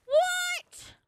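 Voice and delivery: high, stretched cry of disbelief; can't believe what just happened; shocked what. Real, not acted. Player reacting to chat, high stretched shocked cry.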